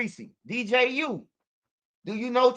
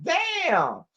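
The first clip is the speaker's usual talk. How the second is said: moved exclamation; 6 semitones higher than usual. Retold or imitated. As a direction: exclaim like you're about to cry too. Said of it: "I'm like".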